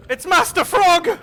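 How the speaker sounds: high, loud, drawn-out shout; over-the-top cry of recognition; excited yell. Pretend. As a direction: announce it in a slow high holler. Yelling at game creature.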